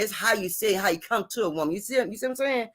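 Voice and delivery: normal talking voice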